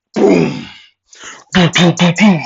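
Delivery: impact sound effects. Acted. Storyteller imitating the blow connecting, in punchy noises. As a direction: voice the blast and its echoing hits.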